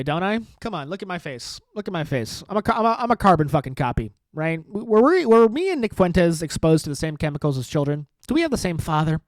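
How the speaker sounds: ordinary talk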